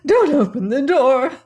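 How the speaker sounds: high, loud panicked cry. A narrator's reading. Old aunt crying out in panic to the girls. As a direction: shriek in terror to stop them. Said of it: "cried Missus Stevens in a panic".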